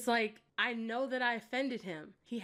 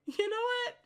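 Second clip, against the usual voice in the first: laughing imitation; 8 semitones higher, 3 dB louder than usual. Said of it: "He was just like".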